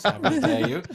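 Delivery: laughing, high mock indignation; mock outrage. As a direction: play offended while giggling. Teasing about hands under desk.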